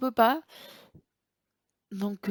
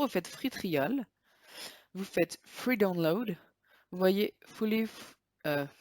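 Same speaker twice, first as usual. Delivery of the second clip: slow, careful English with a French accent; slower than usual. Real, not acted. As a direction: spell out the English words slowly in a French accent. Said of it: "Désolé pour mon anglais qui est pas très terrible". (anglais lent avec l'accent français)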